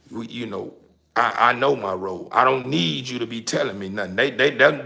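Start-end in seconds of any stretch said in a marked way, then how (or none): none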